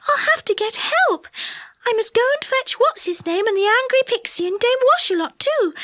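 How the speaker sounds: high, anxious fairy; Silky voice. A narrator's reading. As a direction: warn them in a worried high voice. Silky decides to fetch help.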